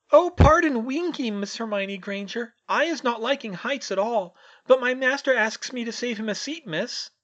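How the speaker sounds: very high, loud trembling elf squeak; timid house-elf greeting a stranger, quivering squeak. Acted. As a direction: squeak it out, small and nervous. Winky explains, frightened.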